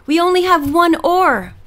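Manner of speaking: put-on boy hollering from another boat; hollered cry. A narrator's reading. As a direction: shout across the waves for help. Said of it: "Aaron hollered".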